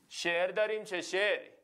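normal talking voice

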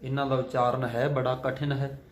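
ordinary talk

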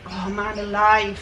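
slow, frustrated Welsh accent sigh. Real, not acted. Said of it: "My Welsh accent comes out when I start to get frustrated".

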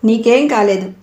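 emphatic comforting doctor; reassuring. A narrator's reading. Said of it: "నచ్చ చెప్పేసరికి".

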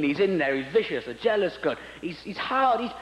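ordinary talk

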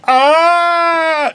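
high startled yelp; mock scream. Woman pulled off an elephant, high startled yelp. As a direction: yelp like falling off.

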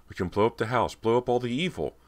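high, excited rush of a plan; urgent cry. A narrator's reading. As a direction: cry it out, pitch climbing. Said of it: "Cody cried".

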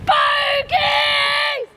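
high, long yell in the shouting game. Shown as a demonstration. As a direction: holler it out, stretching the end. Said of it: "you're, like, shouting".

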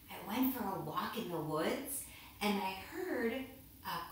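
plain reading voice

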